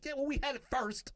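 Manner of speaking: high-pitched, quick comeback; indignant retort. Acted. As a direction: protest it high and fast. Acted indignant retort about the word.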